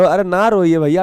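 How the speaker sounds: usual speaking voice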